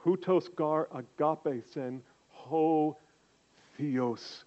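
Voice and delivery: slow, careful "Hutos gar agape sen"; Greek reading. Shown as a demonstration. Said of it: "here's how it sounds in Greek".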